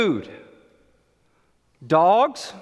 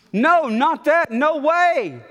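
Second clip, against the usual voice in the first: Peter refusing; 7 semitones higher, 3 dB louder, slower than usual. Retold or imitated. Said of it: "But I said".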